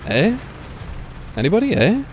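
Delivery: high questioning calls; rising high "anybody?"; calling out. Real, not acted. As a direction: call out for a teammate. Calling out to absent team.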